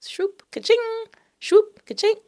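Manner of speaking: high, loud shoop and ka-ching; playful app sound effects. Shown as a demonstration. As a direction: play both swipe sounds. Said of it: "that's the sound it makes".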